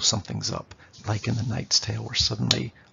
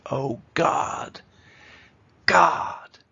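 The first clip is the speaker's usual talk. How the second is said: "Oh God" rising to a high, loud, breathy "God"; anguished cry; 4 semitones higher, 6 dB louder, much slower than usual. Shown as a demonstration. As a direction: voice Hamlet's despair. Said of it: "he's really upset".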